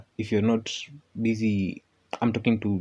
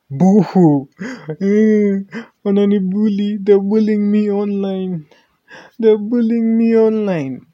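high, loud mock crying whine; boo-hoo like a crybaby; fake crying; 10 semitones higher, 7 dB louder than usual. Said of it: "because of the online bullying".

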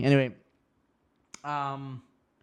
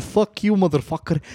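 movie line quote; 6 semitones higher, 4 dB louder than usual. Retold or imitated. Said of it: "I love that fucking scene".

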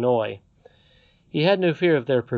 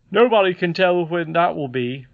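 higher, emphatic storybook father; musing father figure; 5 semitones higher, 7 dB louder than usual. Acted. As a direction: put the question earnestly in a raised voice. Voices Peter in story.

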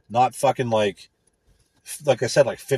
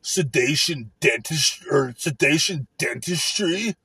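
mock dumb coworker; 4 semitones higher, slower than usual. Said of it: "it's like".

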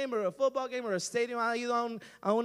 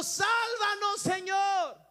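higher, loud cry for rescue; crowd shouting; 8 semitones higher, 6 dB louder, much slower than usual. Acted. Crowd crying to Jesus on the donkey, shouted. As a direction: call out to him as he passes. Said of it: "estaban gritándole a Jesús".

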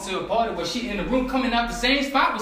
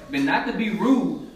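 normal talking voice

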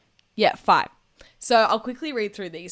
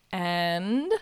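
ordinary delivery

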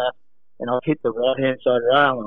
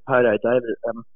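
normal talking voice

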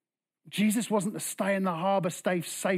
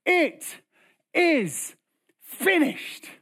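high, loud, one word at a time; final cry; 11 semitones higher, 4 dB louder, much slower than usual. Acted. Jesus's last words on the cross, high broken-up cry. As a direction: push each word out with a pause between. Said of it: "with his final breath, he says".

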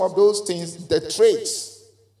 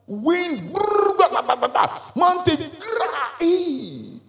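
dramatic sound-effect wind and breaking rock; imitated wind roaring; 8 semitones higher, slower than usual. Shown as a demonstration. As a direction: act out wind smashing a mountain with mouth noises. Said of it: "See the things that happen".

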